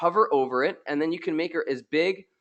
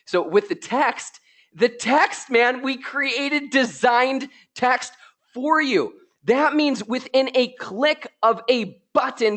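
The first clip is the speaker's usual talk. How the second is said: drawn-out excitement; 10 semitones higher, slower than usual. Real, not acted. Showing off designed text.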